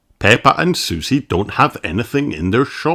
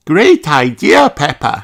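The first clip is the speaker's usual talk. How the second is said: put-on sheep girl voice; 6 semitones higher, 5 dB louder than usual. Susie voiced.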